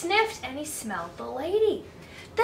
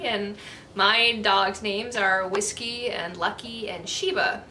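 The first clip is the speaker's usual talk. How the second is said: usual speaking voice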